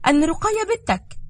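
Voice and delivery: breathless with bad news; anxious daughter. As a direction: daughter panicking on the phone.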